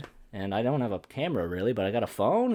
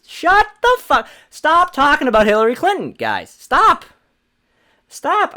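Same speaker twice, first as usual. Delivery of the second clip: much higher, louder furious yell; outraged screaming stop; angry shout; 10 dB louder than usual. Real, not acted. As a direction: yell them down. Yelling at Clinton fans.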